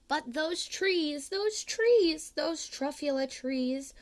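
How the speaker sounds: rising high and loud in wonder; breathless awe building to a high, loud cry; awed rapture. Acted. Onceler marvels at the trees.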